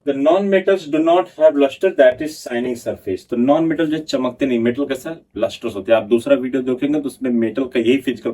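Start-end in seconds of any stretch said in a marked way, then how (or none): 0.1-3.3 slow, careful dictation, a little higher; slow note dictation to a class; dictating notes. Acted. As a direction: read it out slowly so students can write.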